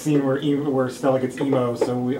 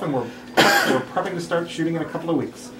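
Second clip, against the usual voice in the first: ordinary talk, nothing marked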